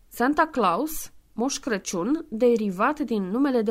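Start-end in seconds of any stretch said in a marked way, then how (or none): none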